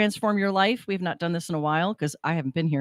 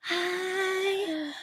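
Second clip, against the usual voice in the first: imitating the singer; 12 semitones higher, 8 dB quieter than usual. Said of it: "She sounded like this".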